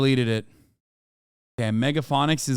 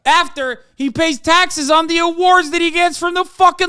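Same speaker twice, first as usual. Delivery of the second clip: much higher, louder, spitting scorn; furious sarcasm; 13 semitones higher, 6 dB louder than usual. Real, not acted. Mocking the billionaire's tax bill, high furious sarcasm. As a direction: build to an almost shrieking jab. Angry sarcastic rant continues.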